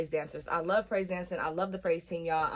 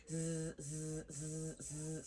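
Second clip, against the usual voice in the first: low, quiet repeated phone buzz; 3 semitones lower, 14 dB quieter, much slower than usual. Retold or imitated. Phone buzzes in quiet church, soft imitated buzzing. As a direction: imitate a phone vibrating in short bursts. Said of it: "So you can hear the".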